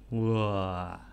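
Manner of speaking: very low, soft sighing wah; long exhaled "wah". Shown as a demonstration. Speaker after leading the gratitude exercise, low soft exhale. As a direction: let out a long, quiet breath of relief.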